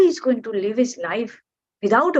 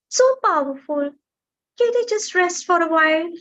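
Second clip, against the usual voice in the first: high, begging small bird voice; pleading little bird; 7 semitones higher than usual. Acted. Bird begging the mountain to let it rest, high and pleading.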